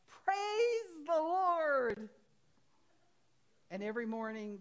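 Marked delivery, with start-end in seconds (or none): 0.1-2.1 long, high, loud cry of praise; joyful shout. Retold or imitated. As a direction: shout it to the heavens, drawn out. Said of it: "every morning and shout".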